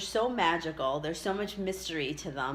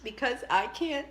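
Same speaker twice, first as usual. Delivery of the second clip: tearful, high and breaking voice; crying; 6 semitones higher, 5 dB quieter than usual. Real, not acted. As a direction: choke up through the words. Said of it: "this could make me cry".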